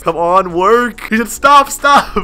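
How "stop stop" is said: high, loud acted protest; mock distressed yell. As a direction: yell it like an upset homeowner. Acts out owner's chat message.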